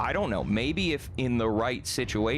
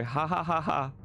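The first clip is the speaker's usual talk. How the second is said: pitched-up, measured mock laughter; fake laugh; 6 semitones higher than usual. Streamer telling Sugoi it's so funny, sarcastic fake laugh. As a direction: say each "ha" as a forced laugh.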